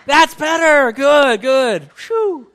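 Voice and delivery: loud, high, delighted; excited praise. Real, not acted. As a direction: cheer the crowd on. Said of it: "congregation finally responds, excited".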